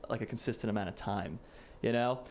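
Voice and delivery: ordinary talk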